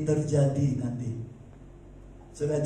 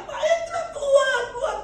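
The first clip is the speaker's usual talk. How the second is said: imitated neighbour's yell. Neighbours warning preacher to get out, imitated high yelling. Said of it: "Pak Herman sama Bu Herman teriak".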